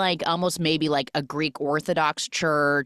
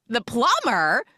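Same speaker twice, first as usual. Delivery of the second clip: higher-pitched, rising mock bluff; sheepish excuse; 7 semitones higher, 3 dB louder than usual. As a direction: try the lame excuse as a question. Repeats the feeble excuse.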